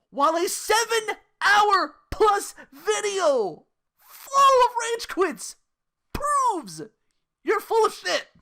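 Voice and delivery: high, loud, drawn-out words; slow emphatic rant. Real, not acted. Calling out the streamer, slow, loud and high. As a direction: hammer each word slowly.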